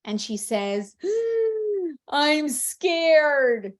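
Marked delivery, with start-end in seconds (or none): 1.0-2.0 high, sharp mock-frightened gasp; big sucked-in gasp; emphatic gasp. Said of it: "she's a little emphatic". 2.1-3.7 louder, higher playful scare; hammed-up fear, drawn out; emphatic pretend fright. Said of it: "she's a little emphatic".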